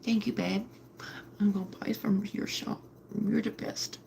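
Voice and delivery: soft whispered thanks; hushed, touched whisper; grateful whisper. Real, not acted. Thanking a viewer softly.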